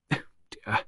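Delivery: soft unvoiced endearment; a breath of a word to her husband; whispered dear. To her husband while pruning, whispered.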